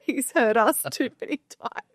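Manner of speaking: high-pitched, laughing as you speak; amused laughter. Real, not acted. Podcaster on the dog barking upstairs, laughing.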